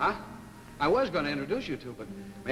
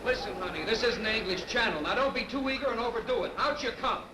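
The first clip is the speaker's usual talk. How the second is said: higher, kindly coaxing voice; gentle coaxing; 7 semitones higher than usual. Acted. Coaxing kids out of pool.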